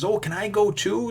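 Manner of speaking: put-on high, eager little boy asking to tag along; kid voice. Young son begging his dad to come hunting, high kid voice.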